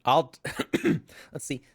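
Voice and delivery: ordinary delivery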